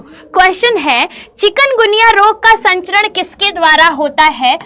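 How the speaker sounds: usual speaking voice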